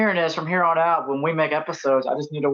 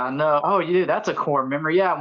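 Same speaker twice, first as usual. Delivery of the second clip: usual speaking voice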